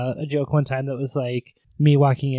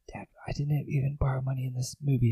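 hushed whisper, acted; whispered reply; 6 dB quieter, faster than usual. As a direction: whisper a meek protest to dad. Acted son answering his scolding dad.

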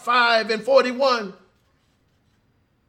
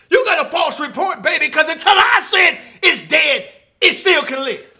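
bold declaration; 4 semitones higher, 5 dB louder than usual. Acted. Jesus declares the child alive.

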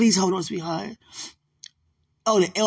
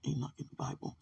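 whispered search under the breath; soft whispered aside; 3 semitones lower, 14 dB quieter, faster than usual. Real, not acted. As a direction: breathe it out softly to yourself. Searching quietly, muttering to self.

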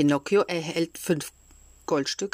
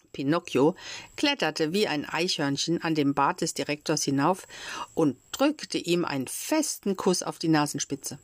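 ordinary delivery

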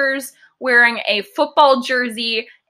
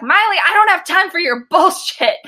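higher-pitched, fed-up dismissal; mock exasperated dad; 6 semitones higher than usual. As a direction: imitate a dad who has no patience left. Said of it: "that's the tone of it".

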